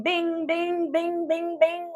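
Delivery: ping them out like little alert bells; imitated chimes. Imitates confirmations chiming in rapidly.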